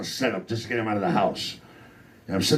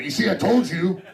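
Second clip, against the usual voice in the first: triumphant; 8 semitones higher than usual. Retold or imitated. Comic to the cops after the brother arrives, triumphant gotcha. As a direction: rub it in, vindicated.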